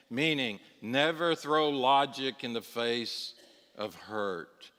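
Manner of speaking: ordinary talk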